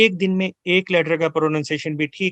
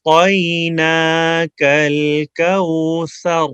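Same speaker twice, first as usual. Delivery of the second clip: slow melodic tajweed, اِنَّا held long; Quran recitation; 4 dB louder, much slower than usual. Shown as a demonstration. Teacher recites the verse with tajweed, slow chant. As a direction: chant the verse slowly with heavy طا.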